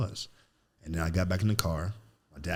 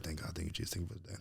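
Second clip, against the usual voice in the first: much softer murmured thanks; quick prayer; 12 dB quieter, much faster than usual. Retold or imitated. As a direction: murmur a quick private prayer under your breath.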